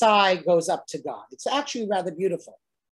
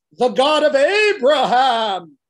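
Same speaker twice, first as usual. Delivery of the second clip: louder, slow, grand divine voice; God announcing; 4 semitones higher, 8 dB louder, slower than usual. Acted. God introduces himself to an unimpressed Moses, grand announcement. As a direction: announce yourself with weight. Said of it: "And then God says".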